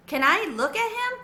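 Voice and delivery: put-on high small child asking; little boy voice. A narrator's reading. Little brother Carlos asks.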